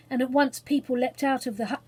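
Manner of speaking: ordinary delivery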